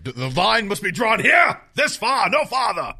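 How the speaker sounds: high, loud, slow theatrical shout; dramatic declaration. Acted. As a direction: draw the line with hammed-up defiance.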